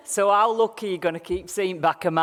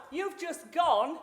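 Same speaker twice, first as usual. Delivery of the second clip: ordinary delivery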